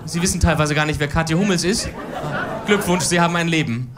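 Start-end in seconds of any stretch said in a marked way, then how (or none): none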